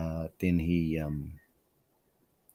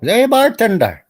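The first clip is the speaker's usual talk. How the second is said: high, loud hammed-up Irish call; Irish accent; 13 semitones higher, 8 dB louder, slower than usual. Irishman calling the bartender, loud Irish accent.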